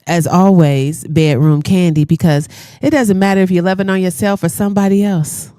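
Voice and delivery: ordinary talk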